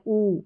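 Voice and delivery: "ऊ" as a long rounded oo; long oo demo. Shown as a demonstration. Teacher shows learners the big double-o sound, a long "ऊ". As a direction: hold "ऊ" long, not short. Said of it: "जो बड़ा ऊ है ना".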